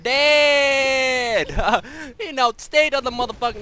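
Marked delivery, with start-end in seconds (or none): 0.0-1.8 high, loud, long-held cry; drawn-out kill shout. Real, not acted. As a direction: stretch the word out in a raised victory yell. Gamer celebrating a kill while playing.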